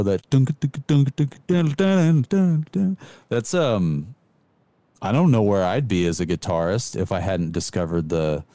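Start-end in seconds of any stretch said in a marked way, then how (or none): 0.3-3.0 high rhythmic guitar-echo noises; imitated delay echo. Shown as a demonstration. Guitarist showing that echo of delay pedals, high vocal imitation. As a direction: mimic a delay pedal, climbing higher in repeats. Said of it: "of that echo".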